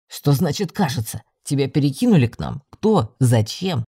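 low male voice firing questions; deep-voiced werewolf demanding answers; questioning werewolf. A narrator's reading. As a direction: pepper her with questions in a deep voice. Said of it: "Начал сыпать вопросами оборотень".

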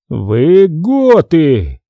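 very slow, drawn-out interrogation; lightly voiced stern chief; stern question. A narrator's reading. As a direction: question them sternly, stretching each word. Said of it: "строго спросил он".

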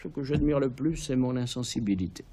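a hint of a bored, serious emperor. A narrator's reading. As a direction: say it flatly, as if yawning. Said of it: "il bâille et dit avec sérieux".

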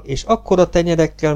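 plain reading voice

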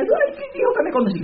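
remedo burlón muy agudo, entre risas; búrlate de la gente que no se lo cree; burla incrédula. Pretend. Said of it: "hay gente que le dice". (remedando entre risas a la gente incrédula, muy agudo)

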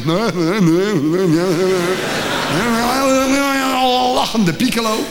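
a dad droning on behind the wall; imitating father's mumble through the door; father's murmur. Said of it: "Mijn vader was aan de beurt om te roezemoezen".

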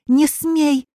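high-pitched, urgent forbidding; alarmed, indignant command; sharp protest. A narrator's reading. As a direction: cry out to stop a fight. Alochka breaks up a scuffle.

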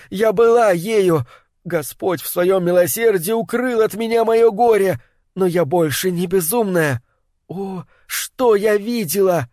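high, tearful insistence; sobbing plea. A narrator's reading. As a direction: insist through sobs. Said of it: "в голосе её были рыдания".

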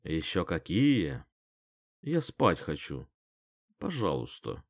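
slow, soft, low sleepy whine; whining. A narrator's reading. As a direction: whimper it, begging to sleep. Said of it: "Захныкала лиса".

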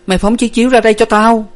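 high, fast, loud order; hurried call. A narrator's reading. Elder sister calls to Hà for a mat, hurried call. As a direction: call out urgently for it. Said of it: "Nguyệt vội gọi Hà".

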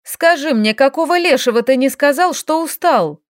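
loud furious bark; angry bark. Acted. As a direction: bark at him, menacing. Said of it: "Так грозно рявкнула она".

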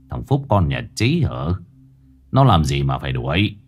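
put-on frowning old man. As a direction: voice an old villager, mildly frowning. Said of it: "nhăn mày".

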